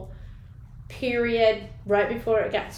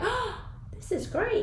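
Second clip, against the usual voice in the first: delighted gasp; 12 semitones higher, 4 dB quieter than usual. Retold or imitated. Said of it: "and I was like".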